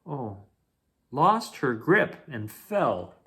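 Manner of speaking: usual speaking voice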